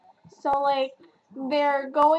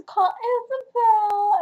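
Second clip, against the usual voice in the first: higher, louder, voice breaking into tears; choked-up crying; 10 semitones higher, 5 dB louder, slower than usual. Real, not acted. Reviewer tearing up over Cole's first call, voice breaking. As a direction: let it catch as the tears come.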